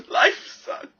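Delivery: loud, breathy wail; mock despair. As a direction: blurt it out like breaking down. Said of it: "burst out crying".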